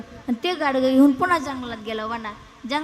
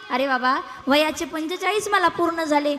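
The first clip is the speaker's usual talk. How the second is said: normal talking voice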